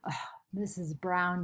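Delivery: whispered mock student complaint; slow breathy whisper of a tired student; whispered imitation of a student groaning; whining student. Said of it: "you guys will tell me".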